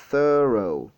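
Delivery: slow "thorough" with a long first syllable; stretched first syllable. Shown as a demonstration. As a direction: draw out the start of "thorough", then fall. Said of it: "la première partie sera un peu étirée".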